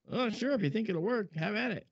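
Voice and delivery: lightly voiced relaxed boss; easygoing boss. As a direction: toss off an easy okay. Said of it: "And he goes".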